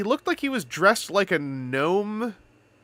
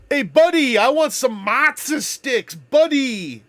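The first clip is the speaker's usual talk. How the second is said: loud, hammed-up Canadian order, "buddy" called out; Canadian customer; 7 semitones higher, 5 dB louder, slower than usual. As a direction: play a friendly Canadian calling out his order. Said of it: "like when you're in Canada".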